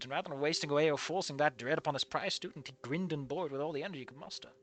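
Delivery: quiet, even reading of the story text; story narration. A narrator's reading. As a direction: read the passage softly, as the narrator. Reads the game's narration.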